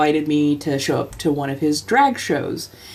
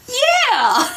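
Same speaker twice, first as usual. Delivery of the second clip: high eager yeah. Retold or imitated. Boyfriend's eager reply.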